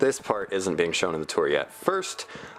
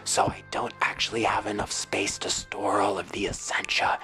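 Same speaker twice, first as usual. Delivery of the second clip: right in your ear. Real, not acted. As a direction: close to the mic, whispering. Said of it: "I'm getting really close to the microphone and whispering".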